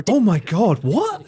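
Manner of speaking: sharp high-pitched what; disbelieving yelp; surprised what. Real, not acted. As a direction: blurt it out in shock. Reacting to a shocking clip.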